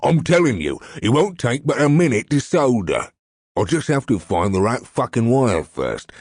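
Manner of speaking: high, loud, angry character voice; exasperated crook telling him off; irritated retort. A narrator's reading. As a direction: scold your nervous partner while working. Acted angry retort to his partner.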